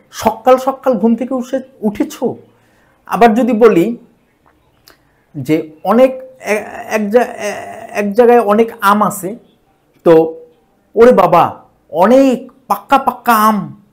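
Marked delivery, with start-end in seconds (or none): 0.1-2.1 সকাল said as সক্কাল with a held ক; emphatic doubled consonant. Shown as a demonstration. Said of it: "একটু জোর দেওয়ার জন্য". 10.9-13.8 পাক্কা with a held doubled ক, amazed; amazed emphasis. Shown as a demonstration. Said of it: "পাকা থেকে পাক্কা পাক্কা".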